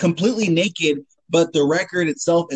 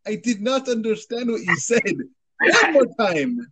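a higher, stiff overly polite apology; mock polite formal voice; 6 semitones higher than usual. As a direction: play a prim foreigner who didn't understand. Acted polite foreigner at chips.

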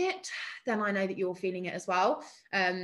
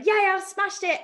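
mock boast; 10 semitones higher, 4 dB louder than usual. Mocking client's premature boast.

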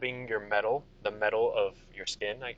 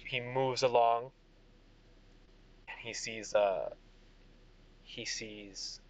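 normal talking voice

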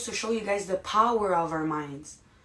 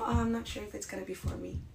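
much softer, small timid voice; imitating a scared newbie, fading softer; mock scared; 10 dB quieter than usual. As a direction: shrink into doubt, barely above a breath. Unsure newcomer quoted.

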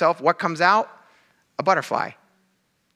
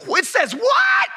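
shrieked, much higher and slower, hammed-up shock; mock freak-out. Pastor mocking a panicked reader of Scripture, hammed-up shriek. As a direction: shoot the pitch way up in mock panic. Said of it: "and freak out".

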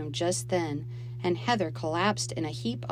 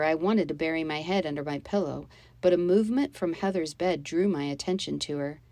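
ordinary delivery, nothing marked

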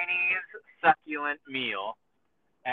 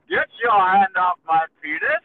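louder indignant protest, a bit higher; retelling the arrest clip, hammed-up yell; mock outraged yell; 5 semitones higher, 6 dB louder than usual. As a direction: shout it like a man being grabbed. Retold arrest, man yelling again.

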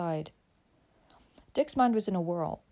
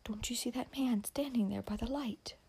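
hushed, higher frightened whisper; soft urgent girl's whisper; 5 semitones higher, 6 dB quieter than usual. A narrator's reading. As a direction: whisper so he won't hear. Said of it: "she whispered".

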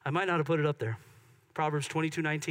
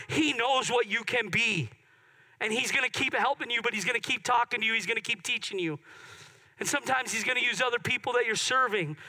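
high, punchy preacher delivery; raised, insistent pulpit voice; emphatic preaching; 7 semitones higher, 4 dB louder than usual. Acted. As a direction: drive each phrase home to the congregation. Preaches with rising force.

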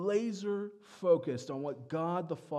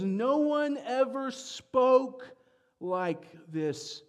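higher, louder, slow amazed cry; awed loud exclamation; 9 semitones higher, 9 dB louder, much slower than usual. Retold or imitated. Officers reporting to the Pharisees about Jesus, loud amazed proclaiming.

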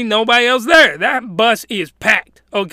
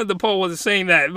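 ordinary talk, nothing marked

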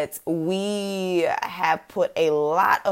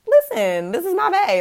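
high-pitched put-on proud partner voice; future boyfriend; 11 semitones higher than usual. Future boyfriend handed the podcast mic, mock proud announcement. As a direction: put on a high voice to show off your sweetheart. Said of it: "hand him the mic and be like".

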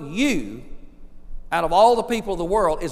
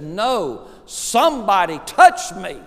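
louder, drawn-out retold insistence; emphatic insisting; 3 semitones higher, 6 dB louder, slower than usual. Jesus to doubting disciples in a crowd, emphatic insisting. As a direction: overrule them, hitting each word.

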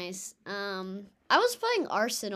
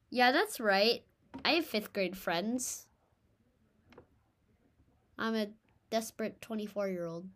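normal talking voice

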